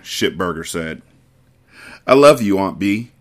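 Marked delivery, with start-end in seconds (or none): none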